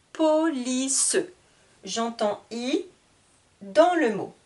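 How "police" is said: "Police" in three beats, Po-li-ce; split into syllables. Shown as a demonstration. Teacher repeating three syllables, "Police" drawn out with final e. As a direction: lengthen each syllable of "Police". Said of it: "Trois syllabes".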